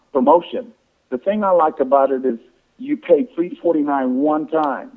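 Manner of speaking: ordinary talk, nothing marked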